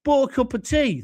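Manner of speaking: ordinary talk, nothing marked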